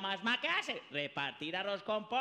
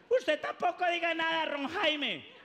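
higher, louder mom snapping back; scolding mother; 6 semitones higher, 4 dB louder than usual. Acted. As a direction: shut the other son up. Mother scolding other son.

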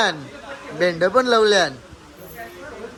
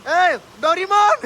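calling out; 9 semitones higher, 5 dB louder, slower than usual. Real, not acted. Calling out to someone playfully.

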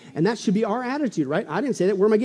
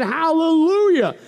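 higher, long-stretched cry of hallelujah; shouted praise; 9 semitones higher, 7 dB louder, slower than usual. Acted. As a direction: draw it out as a triumphant shout. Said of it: "And again they shouted".

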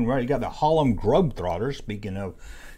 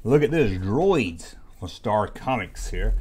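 usual speaking voice

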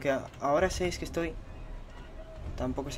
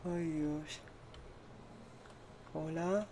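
ordinary talk, nothing marked

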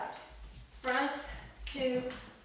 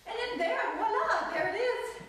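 high, bouncy vocalized rhythm that pops on voila; playful sing-song; 12 semitones higher than usual. Shown as a demonstration. Teacher vocalizing the shaky shake for the class, high and playful.

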